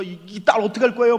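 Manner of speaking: loud, pleading retold cry; desperate plea. Pastor pleading with the dying man over his daughter, loud. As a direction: beg him not to leave his daughter. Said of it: "막 제가 막 그러고".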